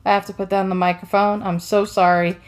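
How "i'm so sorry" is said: ordinary talk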